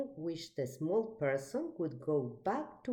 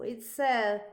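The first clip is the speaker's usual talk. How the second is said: plain reading voice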